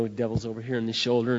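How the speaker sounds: usual speaking voice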